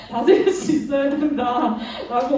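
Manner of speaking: slow, giggling through a quote; laughing through the words. Real, not acted. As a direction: she can't stop laughing while quoting God. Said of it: "전사님이 지금 이 말을 하니까 되게 웃기네요".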